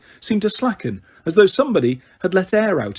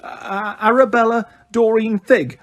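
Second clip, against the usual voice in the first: quavery voice; 7 semitones higher than usual. A narrator's reading. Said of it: "in her quavery voice".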